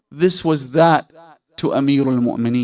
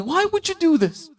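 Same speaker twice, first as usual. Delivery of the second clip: high-pitched acted questioning; incredulous protest. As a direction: voice leaps up to question him in disbelief. Qambar questions the Imam's act.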